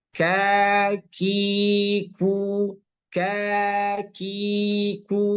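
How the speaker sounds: slow, drawn-out kakiku with a pressed k; kaf pressed. Shown as a demonstration. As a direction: stretch kakiku, pressing each k hard. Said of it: "Yang pertama, sifat yang muncul, tekan".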